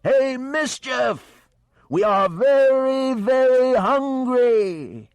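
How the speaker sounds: high, loud, slow teasing shout; hammed-up operatic holler. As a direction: bellow a joking threat across the water. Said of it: "Dede was still hollering".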